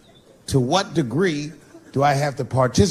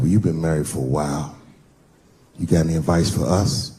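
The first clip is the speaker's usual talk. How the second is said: friend voiced much lower; deep friend's voice; 10 semitones lower than usual. Friend asking Dave for marriage advice, voiced much lower. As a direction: keep the friend low and easy. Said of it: "He said".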